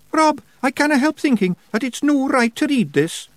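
high, broad Scottish wee man, uneasy; fretting little pixie voice; worried Feegle. A narrator's reading. Billy Big Chin speaks.

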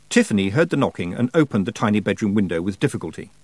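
ordinary delivery

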